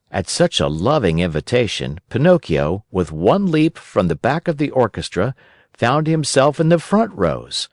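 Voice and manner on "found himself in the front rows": ordinary delivery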